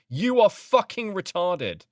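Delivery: outraged. Real, not acted. Reacting in outrage to a comment.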